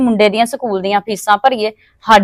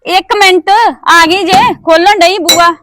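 calling out; 8 semitones higher, 3 dB louder, slower than usual. Acted. Calling out toward the gate.

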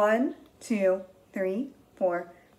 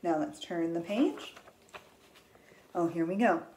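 normal talking voice

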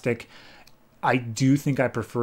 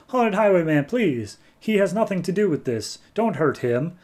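mild, higher-pitched plea; pleading; 6 semitones higher than usual. Retold or imitated. Terrence begs a ghost to spare someone, mildly pleading. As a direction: plead lightly on someone's behalf. Acted plea for another's life.

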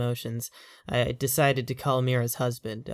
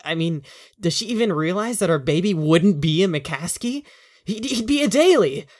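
higher and louder, building to an indignant peak; exasperated outburst; 6 semitones higher, 7 dB louder than usual. Acted. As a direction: let the frustration boil over on Daly. Owen's exasperated outburst about lineage.

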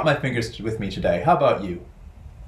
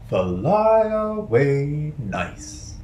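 gentle nice; 4 semitones higher, much slower than usual. Shown as a demonstration. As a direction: coo it kindly to the children. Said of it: "nice bird voiced".

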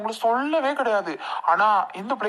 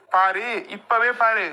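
ordinary talk, nothing marked

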